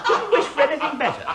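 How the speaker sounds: high, laughing approval; delighted laughter. Acted. Director delighted with madame's comic speech, laughing. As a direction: chuckle through it, delighted.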